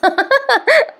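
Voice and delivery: a sudden squeaky giggle; amused high-pitched giggle. Real, not acted. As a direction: giggle high up at her own joke.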